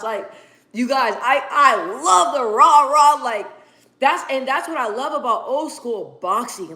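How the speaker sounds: usual speaking voice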